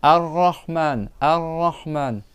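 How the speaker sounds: Ar-Rahman drawn out slowly with a rolled doubled R; slow model recitation. Shown as a demonstration. Teacher to pupils, modelling Ar-Rahman slowly. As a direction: say Ar-Rahman slowly, tapping the R twice. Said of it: "C'est bon, et tu dois le doubler".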